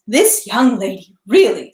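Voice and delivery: lightly voiced grumpy butler, mock disapproval; a touch of put-on sternness; mock frown. A narrator's reading. Butler Foka feigns a frown at the young lady. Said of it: "pretending to frown".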